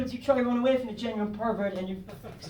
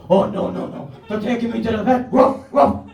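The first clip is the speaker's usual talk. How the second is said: loud doggy panic with barked woofs; panicking puppy; 8 dB louder than usual. Acted. Puppy being driven to the vet, loud frantic protest. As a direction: freak out like a frightened dog. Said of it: "he's freaking out 'cause he thinks".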